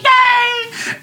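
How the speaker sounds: very high, drawn-out mock squeal; playful squealed celebration; excited cheer. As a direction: cheer like a delighted group of new friends. Acts out the group's cheer.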